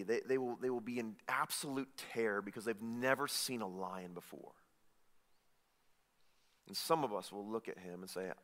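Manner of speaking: low, soft appeal with weighty pauses; quiet, heartfelt sermon appeal; hushed solemn preaching. Real, not acted. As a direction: press it gently on each listener, almost murmured. Solemn hushed application to listeners.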